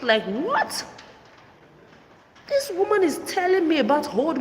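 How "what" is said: high shocked "What?"; reliving her own shock, pitched up; a stunned high "What?". Retold or imitated. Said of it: "I was shocked".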